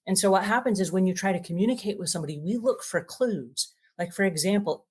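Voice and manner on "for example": ordinary delivery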